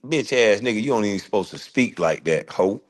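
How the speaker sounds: normal talking voice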